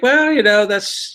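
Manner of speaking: breezy, too-casual start; mock nonchalant. Acting casual before HOA lady.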